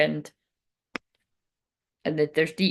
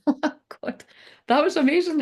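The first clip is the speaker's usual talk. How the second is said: laughing; 8 semitones higher, faster than usual. Real, not acted. Laughs at how the cards fell.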